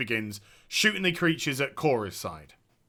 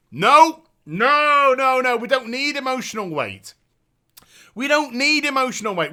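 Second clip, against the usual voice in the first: higher mocking no no no; sarcastic dismissal; 6 semitones higher, 3 dB louder than usual. Real, not acted. Streamer sarcastically voicing the writers, higher and mocking. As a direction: sneer at the writers' choices.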